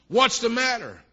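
ordinary talk, nothing marked

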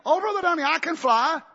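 high mock brag; a hammed-up proud believer; mock boasting churchgoer. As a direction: lift the voice to mimic a boaster. Mocking a church member boasting.

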